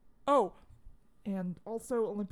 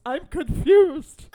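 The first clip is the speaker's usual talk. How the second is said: higher, much louder, slow wailed line; crying grandpa; 7 semitones higher, 17 dB louder, much slower than usual. Retold or imitated. Telling how the crying grandpa answers what's wrong, wailing. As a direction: wail it like a weeping old man.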